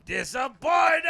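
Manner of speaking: loud high yell of the word; mock yell. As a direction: bellow it out like a bad actor. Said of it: "he's supposed to scream".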